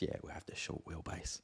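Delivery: whispered under the breath; whispered repeat. Retold or imitated. Muttered aside repeating the order.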